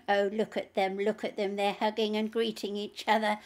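mock them like a jeering child; mocking childlike tone. A narrator's reading. Demon jeering at saints hugging each other, mocking and childlike. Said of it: "mocking, childlike tone".

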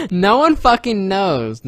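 very loud, high, slow laughing exclamation; exasperated outburst. Real, not acted. Answering a friend's confusion, loud laughing outburst. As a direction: blurt it out laughing.

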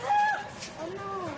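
sudden high yelp; startled yelp. Real, not acted. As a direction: yelp at the spill. Spills crumbs.

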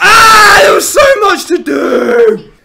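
high, loud, slow wailing cry; anguished wail. Real, not acted. Gamer dying with too much to do, loud slow wail. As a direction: wail it out in despair. Death after jumpscare.

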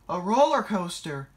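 high, loud alarmed question; crying out. A narrator's reading. Percy dreading his fate, crying out. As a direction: blurt it out in alarm. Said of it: "cried Percy".

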